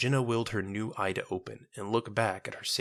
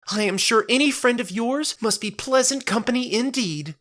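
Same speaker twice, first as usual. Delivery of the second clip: far higher and louder, bright and eager; childlike cheer; 8 dB louder than usual. Acted. Marifold cheerfully agreeing to Jenna's friend, childlike delight.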